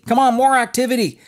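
high, mock-excited church booster, almost singing; mocking hype. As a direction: lift way up and oversell it like a cheerleader for busyness.

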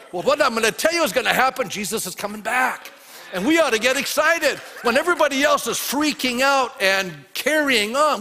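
loud, high, urgent rallying; full-force preaching. Real, not acted. Preacher urging believers to get excited, rousing and loud. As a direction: fire up the whole congregation.

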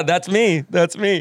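ordinary talk, nothing marked

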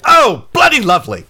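very high, loud exclamation; Quint's character voice. Acted. Quint looks out the door at visitors, delighted shout. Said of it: "and he's like".

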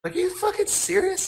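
high, incredulous question; astonished mock-squeal of a question; retold disbelief. Retelling his astonished reaction to winning.

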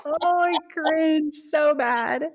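high, drawn-out cringe; cringing. Real, not acted. Co-host reacting to her own quote of the week, cringing. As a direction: groan it out high and slow, squirming.

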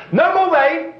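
high, loud mock decree; a hammed-up order to the sky; mock prophet's command. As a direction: bark it out as a cocky prophet. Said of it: "He couldn't just say".

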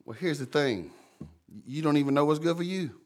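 ordinary talk

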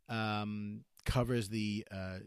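ordinary talk, nothing marked

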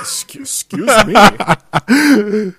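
high, loud, drawn-out mock indignation; mock offended. Co-host reacting to a snub of y'all, mock offended. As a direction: act hugely affronted, stretching the words out.

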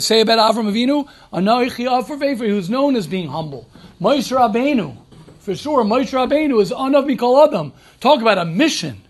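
ordinary talk

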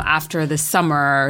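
normal talking voice

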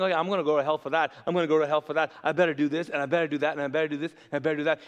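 imitating someone caught on a treadmill of duties; anxious rambling. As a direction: rattle off the worries in a monotonous rush. Voices anxious people listing duties.

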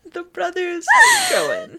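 very high, laughing mock echo of the slip; teasing laugh. Real, not acted. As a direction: shriek the phrase back between laughs. Teasing friend's word slip.

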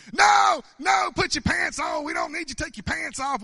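high, loud mock-frantic shout; imitated scream of urgent protest; Vanessa screaming. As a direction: yell it in alarm, imitating a scolding woman. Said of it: "Vanessa started screaming".

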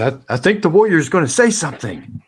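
high, loud crowd voice; hammed-up high-pitched bystander; excited onlooker. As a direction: call it out from the back. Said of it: "this man in the back says".

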